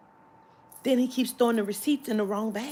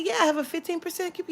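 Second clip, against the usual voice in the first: bright customer voice; 10 semitones higher, much faster than usual. Retold or imitated. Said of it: "I said".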